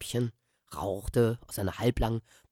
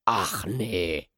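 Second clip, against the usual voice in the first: grinning mock surprise; 5 semitones lower than usual. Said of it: "grinste nun der andere".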